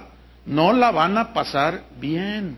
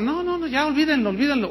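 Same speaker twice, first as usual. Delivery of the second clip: high, mock-breezy brush-off; dismissive pastor; 7 semitones higher, faster than usual. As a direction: wave the sin away like a careless pastor. (pastor despreocupado, burlón)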